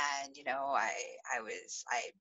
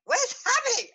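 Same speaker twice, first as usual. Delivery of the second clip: astonished; 5 dB louder, faster than usual. Retold or imitated. Surprise at sudden first kiss.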